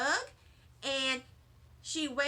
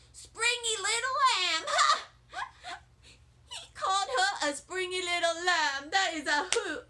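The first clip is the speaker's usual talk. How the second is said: laughing; 6 semitones higher, 3 dB louder than usual. Acted. Junie B. laughing at Sheldon.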